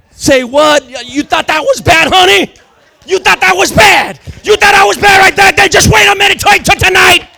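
David roaring back at his wife, full voice; defiant shout. Retold or imitated. David answers his wife again.

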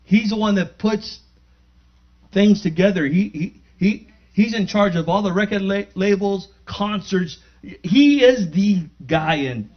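loud, high testimony, words ringing out; fired-up sermon voice; preaching at full force. Acted. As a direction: preach it like a revival.

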